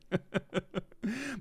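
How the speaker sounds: high, soft staccato giggle; real giggling. Real, not acted. Streamer tickled by Jamie's comment, high soft giggling. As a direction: giggle helplessly, rising at the end. Still cracking up at chat.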